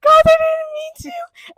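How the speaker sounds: high, loud, slow whiny protest; panicked protest. Retold or imitated. Gabby pleading with the bathroom crowd, high and loud. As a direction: plead your innocence, squealing.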